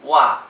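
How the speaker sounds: Wa loud, falling fast from high; second tone. Shown as a demonstration. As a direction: hit Wa high and let it drop quickly. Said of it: "starts very high and goes down".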